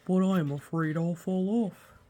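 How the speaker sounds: fretful character voice; worried walrus. Acted. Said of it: "said Walrus".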